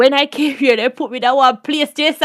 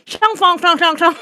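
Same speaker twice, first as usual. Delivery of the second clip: slightly higher mock gibberish chant; mimicked foreign lady; 3 semitones higher than usual. Student imitating the incomprehensible lady, mock gibberish. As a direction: lightly imitate a lady speaking unknown language. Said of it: "This is all we hear the lady say".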